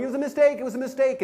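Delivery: high, urgent mock plea; panicked pleading. Naked intruder excuses himself to angry guest, panicked.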